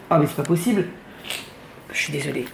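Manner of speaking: sniffly, stuffed-up nose; blocked nose. Real, not acted. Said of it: "pourquoi je renifle comme ça".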